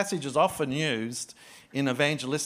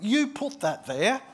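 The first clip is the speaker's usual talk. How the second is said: accusing; 8 semitones higher than usual. Retold or imitated. Retold accusing wife.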